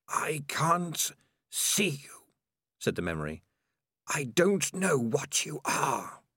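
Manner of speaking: slow, hissing sinister voice. Acted. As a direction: hiss it out, creeping and cold. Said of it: "all hisses".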